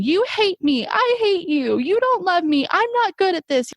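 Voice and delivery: high pitched retold meltdown, a child screaming; mock screaming child. Therapist retelling a girl's screaming at her, high pitched. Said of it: "was just screaming".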